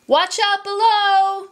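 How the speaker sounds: high, drawn-out call; yelled warning. A narrator's reading. Merchant warns the tiger down in the pit, yelling. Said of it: "He yelled".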